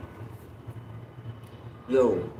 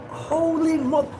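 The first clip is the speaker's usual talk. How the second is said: shocked gasp; 13 semitones higher, slower than usual. Retold or imitated. Shocked reaction.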